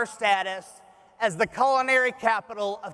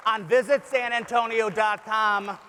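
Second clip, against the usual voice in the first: usual speaking voice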